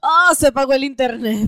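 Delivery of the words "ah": high, loud pleasure cry; mock ecstatic cry. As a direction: act out a thrilled moan. Mock ecstasy at good news.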